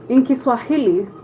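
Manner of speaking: normal talking voice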